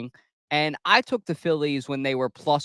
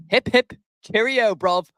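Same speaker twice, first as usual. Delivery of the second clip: a light, mock British accent, higher and louder; 6 semitones higher, 4 dB louder than usual. Host cheering a Mets win to viewers, in a mock British accent. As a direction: play the cheeky Brit cheering the win. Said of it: "use a badass British accent about it".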